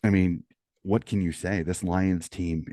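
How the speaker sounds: ordinary talk, nothing marked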